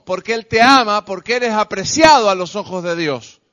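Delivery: high, driving pulpit delivery; fervent preaching. Real, not acted. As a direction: preach it in an unbroken, impassioned run. Preaches at full force.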